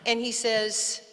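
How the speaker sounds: ordinary delivery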